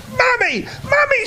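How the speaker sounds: put-on a child calling her mum in delight; high girl's voice, eagerly calling out; excited little girl. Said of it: "she yelled".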